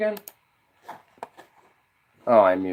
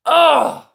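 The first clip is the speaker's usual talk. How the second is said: frustrated groan; 13 semitones higher, 3 dB louder than usual. Real, not acted.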